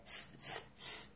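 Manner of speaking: soft hissed monkey chatter; short voiceless tss noises; monkeys' tss. A narrator's reading. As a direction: mimic monkeys with quiet hisses. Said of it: "back at him and said".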